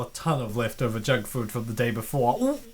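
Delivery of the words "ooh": ordinary delivery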